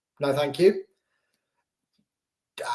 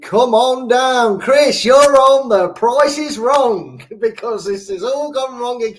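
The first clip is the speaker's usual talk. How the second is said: game show host; 6 semitones higher, 4 dB louder, slower than usual. Pretend. Host calling contestant on game show.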